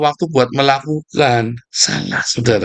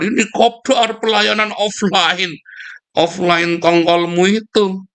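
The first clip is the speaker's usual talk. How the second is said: sarcastic mockery; 7 semitones higher, slower than usual. Sarcastic mockery of offline meetups.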